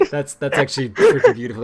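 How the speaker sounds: loud praise broken by laughter; real laughter. Real, not acted. Streamer delighted by a viewer's meme, laughing. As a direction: praise it while cracking up.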